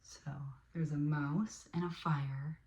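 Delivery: usual speaking voice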